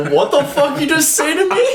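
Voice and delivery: high-pitched mock disbelief; incredulous. Classmates reacting to his slip in class, incredulous. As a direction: imitate a classmate aghast, pitch shooting up.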